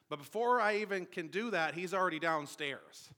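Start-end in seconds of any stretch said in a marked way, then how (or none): none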